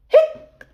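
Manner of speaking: short high squeaky hiccup; spoken cartoon hiccup; little high-pitched hic; mock hiccup. Imitates the monster's hiccup.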